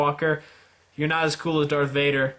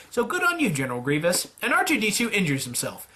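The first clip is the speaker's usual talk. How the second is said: ordinary talk, nothing marked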